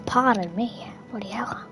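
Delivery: ordinary talk, nothing marked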